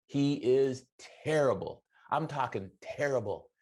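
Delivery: ordinary delivery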